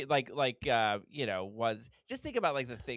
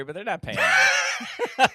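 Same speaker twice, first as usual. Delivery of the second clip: much higher, louder, breaking into laughter; slow, loud joking protest into cackles; laughing shout; 10 semitones higher, 11 dB louder, slower than usual. Real, not acted. Co-host protesting the unpaid plug, laughing shout.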